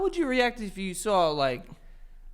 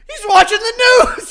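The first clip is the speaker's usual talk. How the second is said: high, loud mock holler; laughing, exaggerated shouted imitation; imitated scream; 10 semitones higher, 10 dB louder, faster than usual. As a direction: yell it like an overexcited fan. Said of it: "and Frankie screams".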